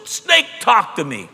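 shouted high whoa, then slow amazed words; mock shock at a talking snake; astonished whoa. Eve to the crowd, hammed-up amazed shout. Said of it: "She didn't go and say".

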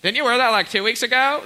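loud, high mock judgment; snarky friend. Imagining God as a judgy friend, loud snark. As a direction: needle him about the outfit. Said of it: "It's like".

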